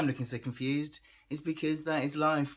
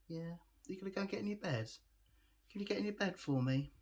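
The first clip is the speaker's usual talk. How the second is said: higher, soft puppy talk; coaxing a puppy; 6 semitones higher, 5 dB quieter, faster than usual. Real, not acted. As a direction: gently ask a dog in a high voice.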